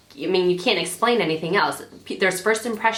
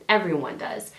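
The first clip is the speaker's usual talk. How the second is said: usual speaking voice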